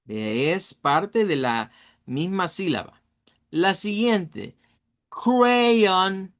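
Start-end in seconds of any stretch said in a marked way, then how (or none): 5.1-6.3 "crayon" drawn out as cray-on, held high; dos sílabas. Shown as a demonstration. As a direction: sound out "crayon" in two joined syllables, cray and on. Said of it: "Cray es la primera sílaba y on es la segunda". («crayon» alargado: cray-on)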